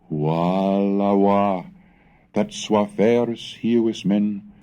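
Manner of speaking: slow, lamenting alas; mild sorrowful sigh. Acted. Gregorius sighing over the heathen boys, slow mild lament. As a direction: let a hint of a long sigh color the words. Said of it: "of inwarde herten langsumme sitchetunge".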